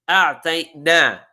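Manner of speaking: a'tayna slow and loud, every syllable weighed; teacher stressing a'tayna slowly; emphatic a'tayna. Shown as a demonstration. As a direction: draw a'tayna out slowly with force. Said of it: "a further emphasis".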